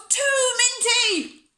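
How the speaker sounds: loud, high, drawn-out wail; tantrum complaint. A narrator's reading. Bella objects to her peas at tea, wailing loudly. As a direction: wail both words in protest.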